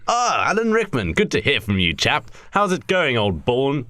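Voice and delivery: a hint of chummy Englishman; mock English accent. Richard Harris greets Alan Rickman on the phone, mock English accent. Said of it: "Really, it's just English".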